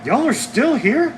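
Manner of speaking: high, loud, slow delighted question; thrilled the viewers stayed; a squealing happy welcome; excited surprise. Real, not acted. Greeting returning viewers excitedly.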